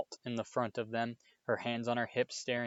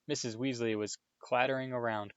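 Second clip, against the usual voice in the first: ordinary delivery, nothing marked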